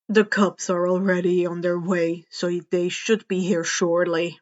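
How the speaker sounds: a hint of a weary pro hero; gruff hero. A narrator's reading. Eraser voiced lower.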